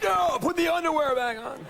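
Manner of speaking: high-pitched mock fan voice; cheering guys. Host imitating guys cheering at the guest, high mock fan voice. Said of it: "the guys like".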